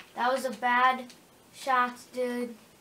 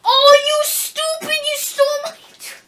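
furious outburst; 14 semitones higher, 13 dB louder than usual. Real, not acted. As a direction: scream at the kill thief. Teammate stole his kill.

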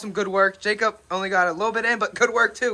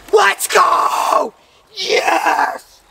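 triumphant shout; 7 semitones higher, much slower than usual. Real, not acted. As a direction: cheer it out, fists up. Finishing the ice bath challenge.

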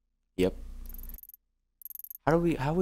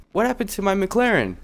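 surprised; 8 semitones higher than usual. Real, not acted. Car missing, high outraged question.

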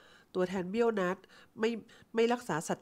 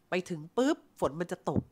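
usual speaking voice

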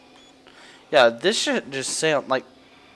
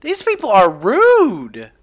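1 drawn-out mock complaint; 4 semitones higher, 8 dB louder, slower than usual. Real, not acted.

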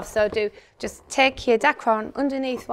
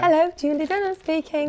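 raised polite phone greeting; telephone voice; 6 semitones higher than usual. Shown as a demonstration. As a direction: answer the phone primly. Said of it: "I'd say".

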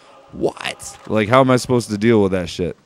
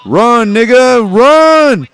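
much higher, louder, slow stretched-out yell; hammed-up frantic shout; mock panicked yell; 9 dB louder, much slower than usual. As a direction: yell at him to run in a silly voice. Urging his character to flee.

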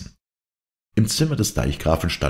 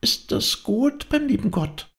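put-on pondering child. A narrator's reading. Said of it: "als müsse es den Worten nachsinnen".

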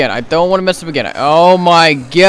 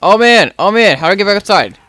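ordinary talk

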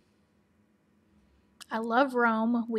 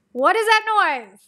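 much louder, high, drawn-out exclamation; loud outburst; 9 semitones higher, 14 dB louder, slower than usual. Real, not acted. Vlogger interrupted by a noise mid-answer, loud outburst. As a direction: burst out loudly through a laugh. Startled by a noise while laughing.

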